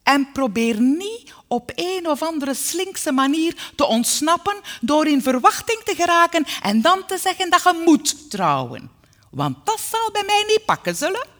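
loud, high, emphatic warning; domineering maternal scolding; firm mother. A narrator's reading. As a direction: lay down the law sternly and loudly. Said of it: "zei ze ferm".

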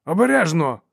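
high, loud warning shout; sharp cry. A narrator's reading. Seeker protests as the stream wets his leg, crying out. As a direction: call out in alarm. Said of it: "крикнув шукач".

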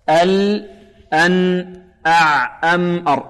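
ال، أن، أع، أم، أر each with a half-flowing last consonant; medium letters. Shown as a demonstration. Quran teacher drills the in-between letters, medium flow. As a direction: let the closing sound of each syllable ring briefly. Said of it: "يعني أن الصوت توسط هنا".

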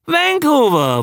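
high, excited exclamation; triumphant recognition. Acted. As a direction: call it out as it clicks, pitch leaping. Exclaims the remembered name. (begeistert hoch ausgerufen)

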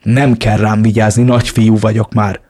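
ordinary delivery, nothing marked

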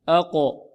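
isolated أق with a bouncing release of ق; qalqalah. Shown as a demonstration. Teacher starting practice, qalqalah on ق. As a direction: bounce the ق at the end of أق. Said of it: "نطبق الآن".

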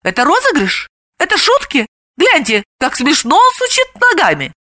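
high, excited little-animal chatter; lightly voiced nervous gossip; timid giggly guessing. A narrator's reading. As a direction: wonder aloud, pitch climbing. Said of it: "пугливо хихикали и гадали".

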